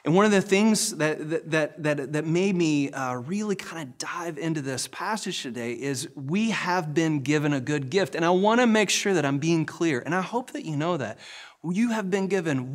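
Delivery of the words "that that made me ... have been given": ordinary delivery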